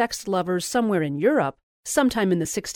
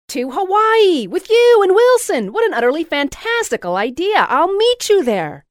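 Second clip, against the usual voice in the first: very high, loud exclamation; ecstatic exclaiming; 11 semitones higher, 9 dB louder than usual. A narrator's reading. Louise exclaiming she will join the trip, very high and loud. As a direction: shriek the plan with joy.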